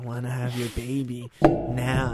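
put-on slow, slurred drunk girlfriend. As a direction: drag it out like a tipsy girl begging. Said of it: "She'd have that drunk voice".

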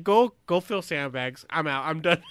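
loud dismissive order, retold; fed-up commander. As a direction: wash your hands of it, firmly and loudly.

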